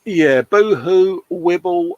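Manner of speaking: slow, hammed-up fake boo-hoo; mock crying. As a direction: blubber like a crybaby, slow and mocking. Mocking Lewis as crybaby.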